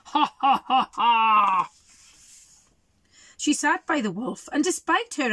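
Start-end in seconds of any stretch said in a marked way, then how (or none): 0.1-1.7 wolf's laugh. Acted. Wolf's gloating laugh in book.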